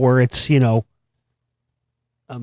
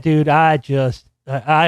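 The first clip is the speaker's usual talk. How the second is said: ordinary talk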